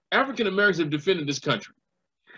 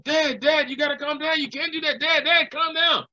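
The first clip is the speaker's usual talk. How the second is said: higher, urgent pleading with his father; son pleading; 9 semitones higher than usual. Retold or imitated. Son trying to calm his upset father, higher urgent pleading. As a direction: hurry to calm an upset dad. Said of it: "His son gets on".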